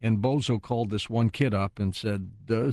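ordinary talk

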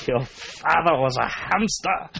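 comic French sneer, drawn out; mock French accent. Trading silly insults with co-host, mock French accent.

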